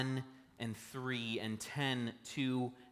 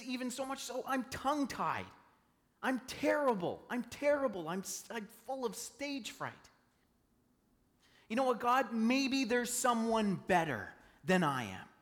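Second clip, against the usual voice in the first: higher, pleading, disbelieving voice; a lightly voiced Moses protesting; doubtful Moses; 9 semitones higher than usual. Moses protesting to God, higher and pleading. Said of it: "He says".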